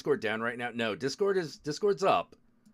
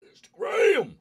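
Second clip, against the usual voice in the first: much higher and louder, a sudden cry of relief; excited shout; 10 dB louder than usual. Real, not acted. Streamer spotting Instagram on his phone, high excited shout. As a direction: shout the name like you finally found it. Finds the app, exclaims.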